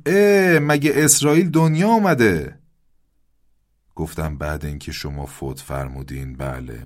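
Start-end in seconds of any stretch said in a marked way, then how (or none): 0.1-2.6 higher, louder startled "what?"; a sudden disbelieving gasp of a question; startled exclamation. Acted. Shah startled by news of Israel.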